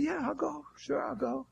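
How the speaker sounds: high, soft, easygoing yes; imitated ready agreement. Preacher voices Moody the yes man, lightly agreeable. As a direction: agree lightly, pitched well up.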